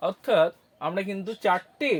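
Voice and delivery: ordinary delivery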